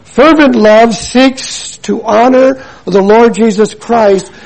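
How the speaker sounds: ordinary talk, nothing marked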